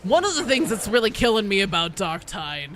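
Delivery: ordinary delivery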